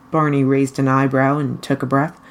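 ordinary delivery

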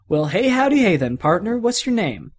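high, loud folksy greeting; Woody welcoming the new toy, bright and folksy; cheery cowboy. Acted. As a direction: greet him like a friendly cowboy.

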